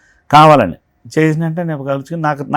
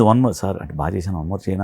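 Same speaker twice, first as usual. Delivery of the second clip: softer, lower shy ask for another take; shy request; 7 semitones lower, 6 dB quieter than usual. Retold or imitated. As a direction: ask timidly for one more. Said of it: "అనేవాడు". (మెల్లగా సిగ్గుగా అడగడం)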